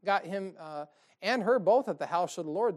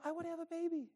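mock husband; 10 semitones higher, 10 dB quieter, faster than usual. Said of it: "you never wake up and go".

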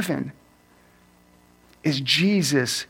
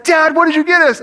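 put-on kids calling out; 10 semitones higher, 5 dB louder than usual. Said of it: "they said".

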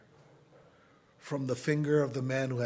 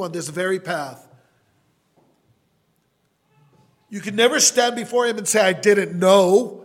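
loud, raised-pitch pulpit delivery; impassioned preaching; 4 semitones higher, 8 dB louder than usual. Real, not acted. Preacher urging the congregation to choose God, forceful preaching.

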